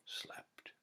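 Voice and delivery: hushed whisper for a sleeping king. A narrator's reading. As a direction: whisper the last word. Narrator hushes the sleeping scene.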